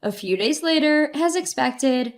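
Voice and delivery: ordinary delivery, nothing marked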